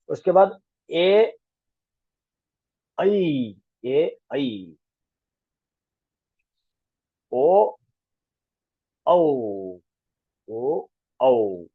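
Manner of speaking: ए, ऐ, ओ, औ said slowly one by one; slow model reading of the vowels; vowel chart recital; reciting vowels. Shown as a demonstration. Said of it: "reciting vowel pairs".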